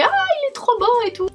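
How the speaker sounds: high, gushing mock delight. Jem seeing the t-shirt, high delighted gush. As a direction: imitate his gush over the shirt.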